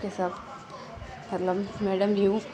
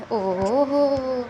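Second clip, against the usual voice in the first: teasing oho; 4 semitones higher, 4 dB louder, much slower than usual. Acted. Wing teasing shy sister.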